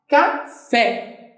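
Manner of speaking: "café" split into ca, pause, FÉ; syllable by syllable. Shown as a demonstration. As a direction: sound out "café" in two separate syllables. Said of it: "Qual é a sílaba mais forte?".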